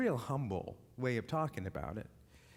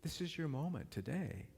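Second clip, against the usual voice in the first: quiet invitation; 6 semitones lower, 8 dB quieter than usual. Acted. Preacher softly inviting the listeners to forgive, low and tender. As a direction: gently invite them to forgive.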